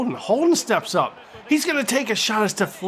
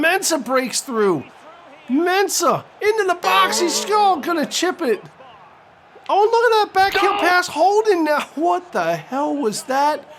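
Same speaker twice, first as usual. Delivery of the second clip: high, loud, breathless build to the pass; soaring play-by-play excitement; excited commentary; 11 semitones higher, 4 dB louder than usual. Real, not acted. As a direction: yell the backheel like you can't believe it. Attack into the box.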